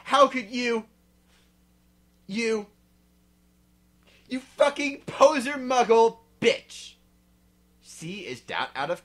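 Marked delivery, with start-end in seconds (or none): 0.0-6.9 high, loud, slow furious insult; angry yelling. Acted. Draco yelling at his girlfriend, enraged. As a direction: spit each word out in rage. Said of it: "he shouted".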